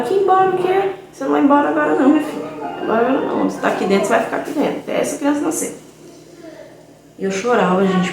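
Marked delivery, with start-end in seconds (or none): 0.0-5.8 higher, indignant imitation; dismissive doctor. Doctor refusing to let the scared girl leave, imitated higher and indignant. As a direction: toss the request aside, mock-indignant.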